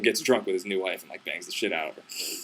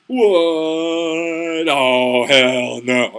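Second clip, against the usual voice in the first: loud, drawn-out indignant wail; mock outrage; 2 semitones higher, 6 dB louder, much slower than usual. Bride sees her husband marrying another, hammed-up outrage. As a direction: build a long "what" into a loud refusal. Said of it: "she gets real mad".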